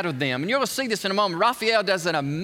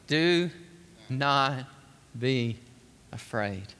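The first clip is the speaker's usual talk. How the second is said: slow word by word, each word falling lower and softer; gentle reassurance; 8 semitones lower, 5 dB quieter, much slower than usual. Acted. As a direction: comfort frightened friends, one word at a time. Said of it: "Jesus comforting frightened disciples".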